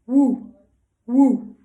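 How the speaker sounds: short, punchy "woo" barks; puppy woof. Shown as a demonstration. Teacher showing children what the puppy says, short loud woofs. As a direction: bark it lightly like a puppy, in separate bursts. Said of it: "And he says".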